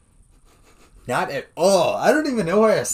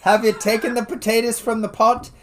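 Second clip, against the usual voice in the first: higher, louder Irish mother; Irish accent; 7 semitones higher, 5 dB louder than usual. Pretend. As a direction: nag about potatoes like an Irish mum. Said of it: "See, that's an Irish".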